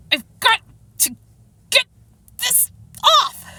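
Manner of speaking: halting, high-pitched effortful heave; grunting through physical exertion; straining with effort. Acted. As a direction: strain word by word as you pull. Straining to pull off a plank.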